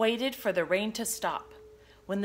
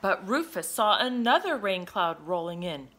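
plain reading voice

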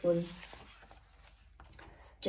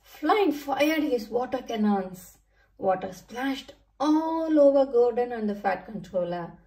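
usual speaking voice

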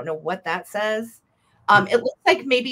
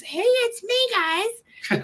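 high squeaky little doll voice, much higher; slower than usual. Pretend. Seller voicing the little doll to her viewers, high squeaky doll voice. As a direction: chirp it like the tiny doll greeting everyone.

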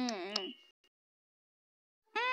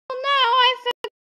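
very fast, higher "oh no, I forgot"; rushed panic; 6 semitones higher, much faster than usual. Pretend. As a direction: blurt it out in a hurry. Shopkeeper realises forgotten cake.

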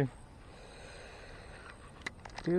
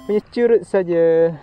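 loud, slow cry over a small catch; real loud groan of a small catch; loud exclamation; 5 semitones higher, 14 dB louder, slower than usual. Real, not acted. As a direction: call it out loud and drawn out. Angler reacts to small catch.

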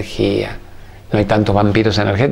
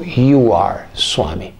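gentle master; much slower than usual. Retold or imitated. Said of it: "Me dijo".

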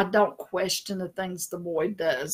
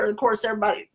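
usual speaking voice

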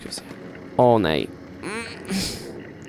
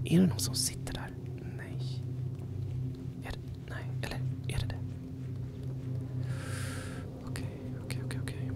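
fast frightened whisper; whisper, scared something is there; nervous whisper; 16 dB quieter, faster than usual. Real, not acted. Checking a dark corner, nervous whisper. Fears someone sitting there.

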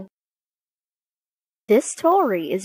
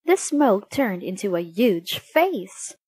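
usual speaking voice

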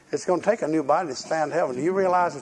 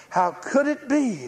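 incredulous cry; 10 semitones higher, slower than usual. Retold or imitated. Believer's doubting cry, voiced.

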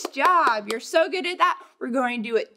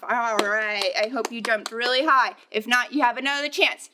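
usual speaking voice

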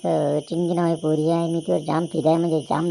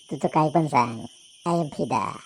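normal talking voice

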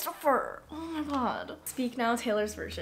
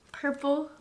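ordinary talk, nothing marked

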